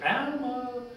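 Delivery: high calling yell up the stairs. Retold or imitated. Girl yelling upstairs to call her friend. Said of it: "went to the stair and yelled".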